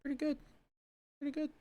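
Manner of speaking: high, soft repeated sing-song. Acted. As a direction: chirp it lightly each time. Repeated in a higher voice.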